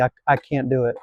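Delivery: ordinary talk, nothing marked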